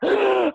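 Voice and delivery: sharp high gasp; shocked gasp. Shown as a demonstration. Showing her bad poker face, sharp gasp. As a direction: gasp as if scandalized. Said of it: "I'm like".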